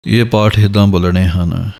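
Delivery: normal talking voice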